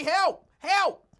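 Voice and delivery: high, loud hammed-up help cry; mock scream for help. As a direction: shriek it like a panicked man. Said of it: "Lincoln was like".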